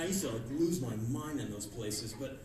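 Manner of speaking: usual speaking voice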